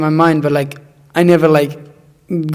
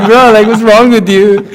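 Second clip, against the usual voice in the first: higher, louder bro voice; hammed-up stoner bro; mock party bro; 6 semitones higher, 6 dB louder than usual. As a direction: ham up a dazed party friend. Quoting party friends.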